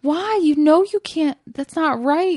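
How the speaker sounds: dad lecturing his daughter in the car, stern; parent scolding. Retold or imitated. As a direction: scold like a dad giving the talk. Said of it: "giving her the whole parent talk".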